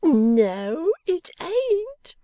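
loud, very high gleeful denial; delighted cry. Acted. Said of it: "cried Missus Boffin".

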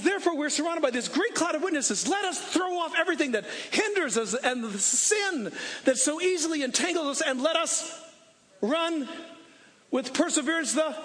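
rally the church like a coach at a game; full-force preaching. Acted. Preaching the Hebrews passage.

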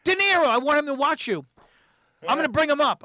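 retold excited friend; eager insisting. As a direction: push it with excitement.

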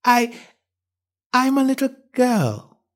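put-on high, slow, hesitant girl voice; falter, unsure, pitched high; doubtful little-girl answer with pauses; rather doubtful. A narrator's reading. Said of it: "rather doubtfully".

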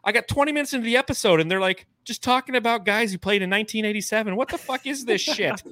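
high, exasperated listener voice; mock annoyed listener. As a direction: whine it like a fed-up fan. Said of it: "They'd be like".

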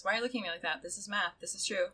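ordinary delivery

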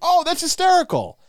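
very high, loud delight; delighted audience. Retold or imitated. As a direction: react like a delighted crowd. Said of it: "people are like".

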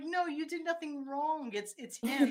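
high-pitched mock protest. Protesting to Simba on his behalf.

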